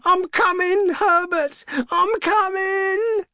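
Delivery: frantic high scream, last words stretched out; struggling cry. A narrator's reading. Mother breaking free and calling to her son, struggling cry. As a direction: scream desperately, calling to someone far away. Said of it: "she cried, struggling".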